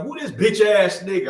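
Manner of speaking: loud, high street challenge; retold loud confrontation; mock tough yell. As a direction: bark it like a young hothead. Retold confrontation with mom's boyfriend.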